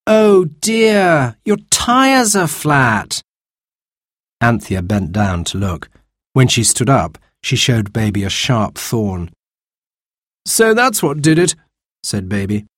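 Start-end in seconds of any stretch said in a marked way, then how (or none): 0.1-3.2 higher, slow fake concern; mock sympathy. Robert feigns sympathy. 10.5-11.6 put-on young man's voice. Baby finds the thorn.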